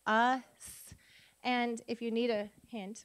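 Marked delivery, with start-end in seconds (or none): 0.1-0.4 Us as uh, then a held loud sss; sounded out. Shown as a demonstration. Teacher sounding out a syllable, Us stretched into two sounds. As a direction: draw out both sounds of Us. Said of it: "Let's sound out each syllable".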